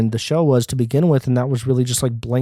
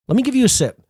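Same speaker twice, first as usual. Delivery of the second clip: higher, playful gossip voice; teasing; 11 semitones higher, 3 dB louder, faster than usual. Acted. Vlogger offering gossip, high and teasing. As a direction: tease it up high.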